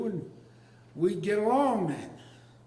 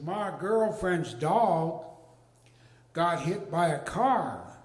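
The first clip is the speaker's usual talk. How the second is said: solemn report; slower than usual. Retold or imitated.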